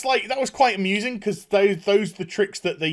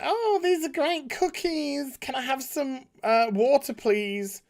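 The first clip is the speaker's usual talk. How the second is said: put-on sweet girly voice; 9 semitones higher than usual. Cindy sweetly asking Pat's mum for water, sugary high voice. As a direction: lay on the fake sweetness. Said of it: "she's just like".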